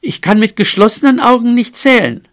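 loud, high-pitched protest; little mouse calling out an objection. Acted. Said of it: "rief er".

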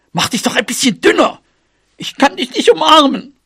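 high-pitched exasperated mouse cry; calling out. Acted. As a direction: call up to your big friend in frustration. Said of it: "rief sie dann". (hoch und empört gerufen)

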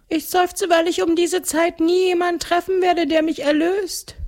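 very high, mournful ghost voice; sad ghost. A narrator's reading. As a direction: lament it like an unredeemed spook. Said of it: "meint das Gespenst traurig".

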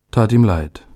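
plain reading voice

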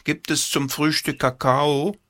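put-on high hopeful child's call; calling boy voice. A narrator's reading. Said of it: "rief Paul".

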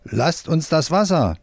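understated shout of protest, drawn out; mild worker's shout. A narrator's reading. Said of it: "bis ein Arbeiter schreit".

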